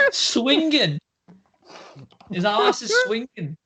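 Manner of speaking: giggling through the words; laughing. Real, not acted. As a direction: laugh while saying it. Laughing at the joke.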